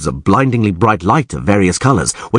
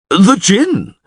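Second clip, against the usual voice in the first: high, raised-voice exclamation; excited shout; 11 semitones higher, 3 dB louder than usual. A narrator's reading. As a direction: call out the startling discovery. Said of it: "shouted Crep".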